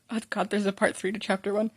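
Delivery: usual speaking voice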